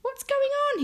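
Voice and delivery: put-on very high, loud boyish question; baffled. A narrator's reading. Franklin asking his dancing mum, baffled. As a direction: ask in squeaky disbelief. Franklin bewildered by mum's silly dance.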